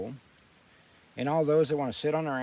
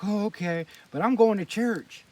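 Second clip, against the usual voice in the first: mock-sincere, rising into a louder, higher pitch; mocking churchgoer; 7 semitones higher, 7 dB louder than usual. Mocking the churchgoers who follow government approval, in a smug imitation. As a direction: mimic a smug hypocrite, getting louder. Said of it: "then they were like".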